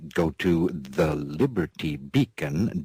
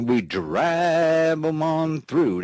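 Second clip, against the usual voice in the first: drawled cowboy line; 9 semitones higher, 4 dB louder, slower than usual. Acted.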